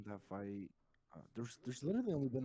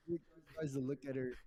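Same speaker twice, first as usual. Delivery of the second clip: ordinary delivery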